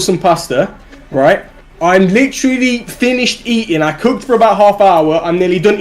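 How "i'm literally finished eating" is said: ordinary delivery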